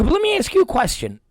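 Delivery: high-pitched, quick lead-in; feigned polite inquiry. Retold or imitated. Parent presses the teacher at conference, high quick voice. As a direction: pitch it up, quick and seemingly innocent.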